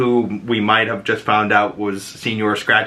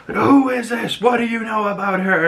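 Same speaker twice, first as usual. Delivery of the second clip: mock interrogation; 11 semitones higher than usual. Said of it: "she tries to show him the pictures".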